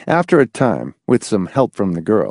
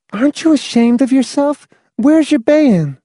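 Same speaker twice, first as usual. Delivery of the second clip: put-on high scolding girl. Girl's voice scolding.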